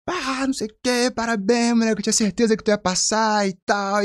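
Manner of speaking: high, breezy carioca buddy; light mock praise; congratulating friend. Friend on the phone congratulates him, high breezy voice.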